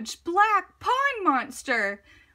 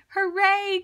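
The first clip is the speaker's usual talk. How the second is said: higher, louder cheer of delight; joyful cry; 7 semitones higher, 6 dB louder than usual. Acted. Frog cheering as the monster turns out a shadow, loud joyful cry. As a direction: shout hooray like a happy frog. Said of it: "cried Frog".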